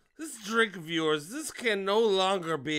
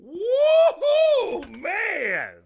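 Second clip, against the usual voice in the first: much higher, loud laughing whoop; whooping cheer; 11 semitones higher, 7 dB louder, much slower than usual. Real, not acted. [name] whoops at the stranger's wit. Cheering friend's love life.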